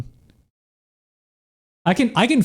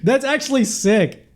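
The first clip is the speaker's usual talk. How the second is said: excited; 9 semitones higher than usual. Real, not acted. Excited reaction, high.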